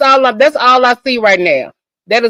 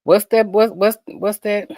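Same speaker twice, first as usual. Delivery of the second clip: ordinary talk, nothing marked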